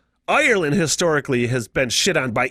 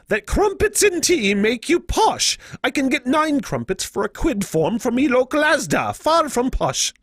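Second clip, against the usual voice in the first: put-on British accent; 9 semitones higher than usual. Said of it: "my British accent's getting better".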